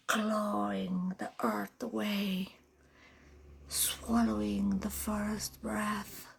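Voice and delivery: slow, hushed creeping narration; horror story whisper. A narrator's reading. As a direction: let the dread crawl out slowly.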